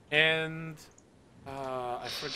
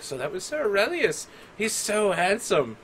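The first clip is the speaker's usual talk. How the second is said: put-on higher-pitched, dreamy girlish admiration; swooning handmaidens; 8 semitones higher, 4 dB louder than usual. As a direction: gush over him like a smitten maiden.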